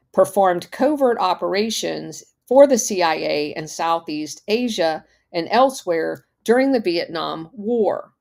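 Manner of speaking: ordinary talk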